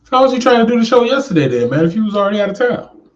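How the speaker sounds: high, fast teasing question; surprised teasing. Real, not acted. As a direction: rib him for skipping town. Teasing friend about vacation show.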